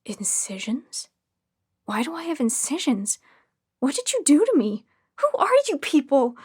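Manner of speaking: horrified patient realizing what was done; terrified. A narrator's reading. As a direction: panic builds from hush to outcry. Patient discovers incisions, terrified.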